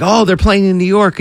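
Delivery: bright high voice of a French fan finding the match; excited tourist. Pretend. Host playing a French fan, delighted at the news. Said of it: "And I'm like".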